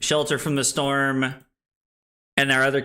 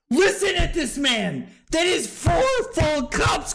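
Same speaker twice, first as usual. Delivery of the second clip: mock indignation; 12 semitones higher than usual. Streamer arguing with Cusco over cups, mock shout. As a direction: call him out in playful outrage.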